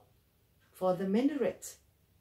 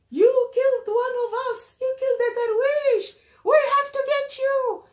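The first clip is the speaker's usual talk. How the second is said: very high, loud screaming threat; piercing, furious yell; enraged mob accusation; 10 dB louder than usual. Acted. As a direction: scream it like an angry crowd member giving chase. Acted mob turning on the narrator.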